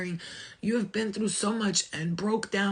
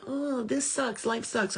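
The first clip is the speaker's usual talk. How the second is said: higher, drawn-out mock whine; mock self-pitying complaint, pitched up and slow; negative complainer; 6 semitones higher, slower than usual. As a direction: drag it out like a hammed-up moaner. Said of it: "not to be that person".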